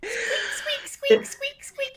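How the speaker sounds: very high squeaky vocal squeaks; squeaking metal imitation. GM makes the cauldron handles squeak, very high.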